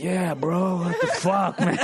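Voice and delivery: low, lightly voiced injured dude; dazed skater. Fallen skater answering a bystander, low mock dazed voice. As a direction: drop low for the banged-up skater.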